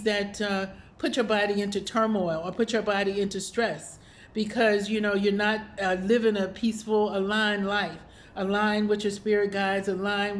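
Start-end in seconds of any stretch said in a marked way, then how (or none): none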